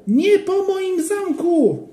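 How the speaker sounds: put-on high, very slow, stretched child's cry. A narrator's reading. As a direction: drag the wail out in despair. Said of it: "krzyknął Joachim". (bardzo przeciągły, płaczliwy krzyk)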